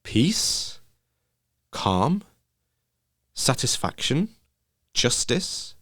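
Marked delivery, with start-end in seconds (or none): none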